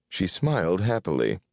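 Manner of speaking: ordinary delivery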